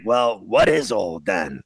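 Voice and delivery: ordinary talk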